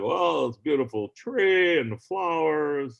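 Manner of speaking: put-on slow, halting old-Hollywood drawl; Jimmy Stewart impression. Comic retelling the legend onstage: slow halting Jimmy Stewart voice. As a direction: read it like a folksy old film star reciting poetry. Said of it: "Jimmy Stewart's up there like".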